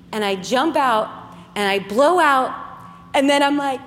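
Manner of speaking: ordinary delivery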